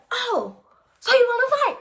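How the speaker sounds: a shrieking outraged mother; furious mom. Retold or imitated. Mom after her daughter kicks her, shrieking furious mom voice.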